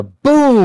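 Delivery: drawn-out, booming sound effect; comic-book boom. Acted. Reading a comic's sound effect aloud, drawn-out boom. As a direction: stretch out a loud, medium-sized boom. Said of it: "it's a medium-sized boom".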